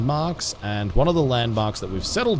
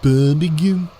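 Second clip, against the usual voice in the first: louder mock Birmingham accent; 3 semitones higher, 6 dB louder than usual. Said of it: "That sounded Birminghamish".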